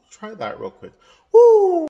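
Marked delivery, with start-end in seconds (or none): 1.3-1.9 loud, high cheering "Woo!"; head voice woo. Shown as a demonstration. Coach demonstrating a head voice whoop. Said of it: "sort of make some sounds".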